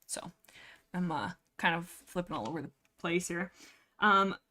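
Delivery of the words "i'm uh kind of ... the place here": ordinary talk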